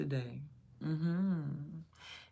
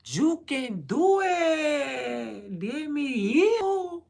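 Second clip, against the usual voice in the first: put-on high, slow, drawn-out cheer at a kid; loud motivating yell; 11 semitones higher, 10 dB louder, much slower than usual. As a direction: pump the kid up, stretched and loud. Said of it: "real loud this morning".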